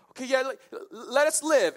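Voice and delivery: pleading. Retold or imitated. Acting out captives' movie-scene plea.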